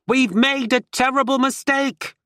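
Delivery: mock-theatrical bad British accent; awful British accent. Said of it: "shouts in this awful British accent".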